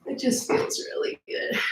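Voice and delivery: soft, airy voice from stretching; breathy, strained. Real, not acted. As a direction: let the stretch squeeze the words out. Stretching on all fours.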